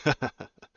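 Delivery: loud, high laugh; real amused laugh. Real, not acted. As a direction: burst out laughing. Host laughs at the corona answer.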